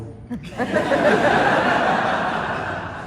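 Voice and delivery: high unvoiced laugh bursts out; big burst of laughter. Real, not acted. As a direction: laugh hard at your own joke. Laughing at own joke.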